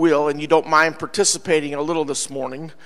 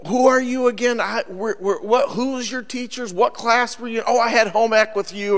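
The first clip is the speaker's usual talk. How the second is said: higher, stumbling confused questions; puzzled classmates; 5 semitones higher than usual. Acted. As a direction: act out baffled reunion guests fishing for clues. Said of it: "They were all like".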